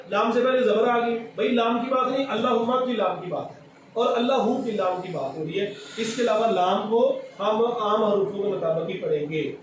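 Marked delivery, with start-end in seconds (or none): none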